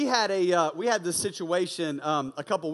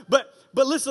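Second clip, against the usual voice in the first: usual speaking voice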